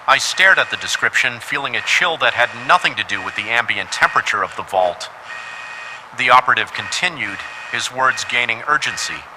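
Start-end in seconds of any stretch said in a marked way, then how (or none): none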